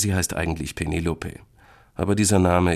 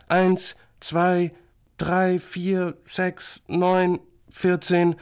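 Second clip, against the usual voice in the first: put-on high, childish count; small boy counting; 13 semitones higher, much slower than usual. Little brother shows his sister he can count, high childish voice.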